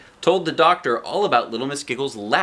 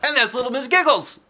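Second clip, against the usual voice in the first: title announcement; 8 semitones higher, 4 dB louder than usual. Shown as a demonstration. Announcing book title and author.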